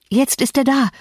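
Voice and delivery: high-pitched warning call; urgent call. A narrator's reading. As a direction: call out the alarm. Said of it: "rief ich". (aufgeregt rufend)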